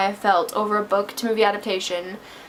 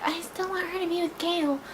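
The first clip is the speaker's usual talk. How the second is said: lightly voiced whiny fan; mocking Gale fans; 7 semitones higher, 9 dB quieter than usual. Mocking moviegoers who still want Gale, whiny. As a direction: mimic a clueless Gale fan. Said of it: "People are still gonna be like".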